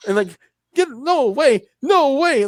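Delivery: put-on loud, high Italian accent; yelling in an Italian accent. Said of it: "Like in his Italian accent".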